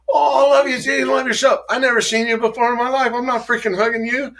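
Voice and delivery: camp it up as the over-eager hugger; mock gushing hugger. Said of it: "the guy would come and say".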